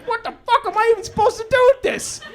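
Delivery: high, fast, incredulous rant; exasperated dad. Retold or imitated. Dad at the laundry machine with the soiled clothes, high and fast. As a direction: blurt it out in disbelief.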